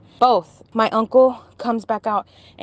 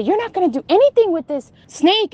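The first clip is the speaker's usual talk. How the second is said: defiant; 9 semitones higher, 5 dB louder than usual. Retold or imitated. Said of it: "and I say".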